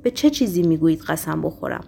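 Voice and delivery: plain reading voice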